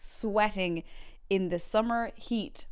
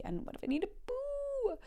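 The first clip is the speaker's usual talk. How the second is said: very high, soft squeal; mock panicked worry; 5 dB quieter than usual. Surfer fretting before hours at sea, squeaky mock worry. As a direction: squeak the worry, drawing out the last word.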